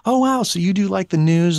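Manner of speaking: high-pitched imitated wonder; lightly gushing, up high; impressed listener. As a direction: mimic an easily impressed woman.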